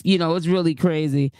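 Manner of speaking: normal talking voice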